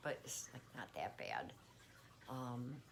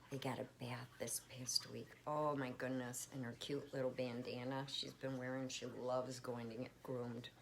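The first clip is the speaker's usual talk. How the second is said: normal talking voice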